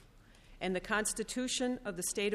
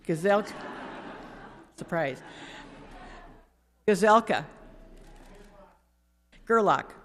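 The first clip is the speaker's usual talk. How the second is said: each name called out much louder, clipped and formal; roll call; 8 dB louder than usual. Acted. Clerk calling the roll of senators, loud and formal. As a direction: call every name across the chamber.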